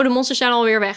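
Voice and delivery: ordinary talk, nothing marked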